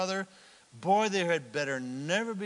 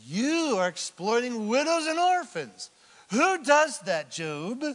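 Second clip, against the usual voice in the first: louder, higher, indignant accusing; mock accusation; 6 semitones higher, 5 dB louder than usual. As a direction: throw the charge at Job like Eliphaz would. Voicing Eliphaz accusing Job.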